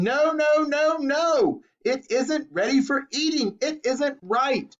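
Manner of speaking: high, frantic no no no; panicked protest. A narrator's reading. Mister Wonka pleading with Violet to stop, frantic and high. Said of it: "wringing his hands and saying".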